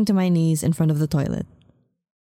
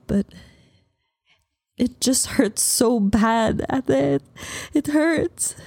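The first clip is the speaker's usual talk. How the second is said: sobbing; 3 semitones higher than usual. A narrator's reading. Heartbroken woman telling her sister, sobbing. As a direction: let the pain spill out. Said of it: "I sobbed harder".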